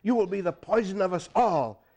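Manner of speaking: loud menacing curse; angry curse. A narrator's reading. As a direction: condemn him harshly. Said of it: "the chief cursed".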